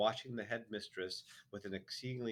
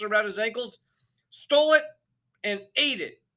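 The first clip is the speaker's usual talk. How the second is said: enraged headmistress; furious tirade; 12 semitones higher, 15 dB louder than usual. A narrator's reading. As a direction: pound every accusation home. Trunchbull's tirade about the cake.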